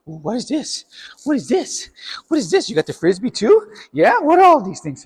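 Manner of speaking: playful rising voice hyping up the dog; excited dog talk. Real, not acted. Playing with dog, showing toys.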